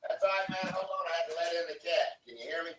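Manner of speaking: distant, echoey and soft, as if in an empty room; away from the mic with echo; echo chamber. Real, not acted. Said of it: "You sound like you're in an echo chamber".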